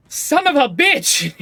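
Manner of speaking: very loud, high, drawn-out curse; furious outburst. Acted. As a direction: explode into a slow, yelled swear. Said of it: "and you hear".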